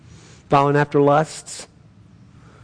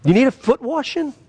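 much higher, slow, rapturous preaching; joyful lift; 11 semitones higher than usual. Real, not acted. Preacher exulting over being cleansed, much higher joyful lift. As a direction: lift the words up in joy.

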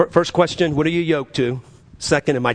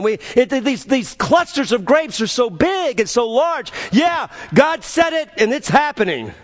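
excited report; 9 semitones higher than usual. Retold or imitated. Spies reporting to the people about the promised land, excited. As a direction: gush it out, voice pitched way up.

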